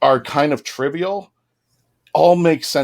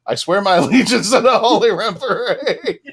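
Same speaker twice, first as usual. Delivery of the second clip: swearing allegiance while cracking up, pitch high; laughing oath; 8 semitones higher than usual. Retold or imitated. Retelling the prisoner's absurd oath to Dave, laughing. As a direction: giggle through the mock oath. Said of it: "He goes".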